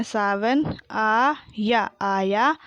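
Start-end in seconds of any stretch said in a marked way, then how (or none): 0.9-1.9 आया split into long aa and yaa; very slow आया, syllables held apart; spelled out. Shown as a demonstration. Teacher sounding out आया for pupils, syllable by syllable. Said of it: "मैंने इस तरीके से पढ़ा".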